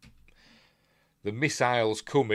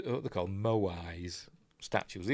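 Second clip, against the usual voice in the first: whispering; 2 semitones lower, 6 dB quieter, slower than usual. Real, not acted. Aside naming the statues.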